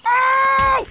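sudden high yelp of shock; jolted by a scare, shout it; startled shout. Real, not acted. Gamer startled by a sudden attack, high shout. Jump scare during gameplay.

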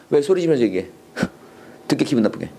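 low mock complaint; grumbling listener. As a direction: imitate an offended listener grumbling.

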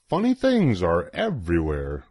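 ordinary delivery, nothing marked

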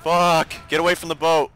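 loud, high alarmed yell; urgent shout. Real, not acted. Boat under fire.

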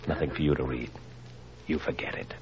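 soft, low warning; menacing whisper. Acted. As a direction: hush her with a threat. Uncle warns her off clipping.

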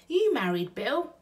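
high, loud, curious woman's voice; Betty asks Bill at the kitchen table, high and nosy; Burglar Betty. Acted. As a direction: pipe up nosily as Betty. Betty's voice in the story.

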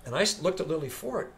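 usual speaking voice

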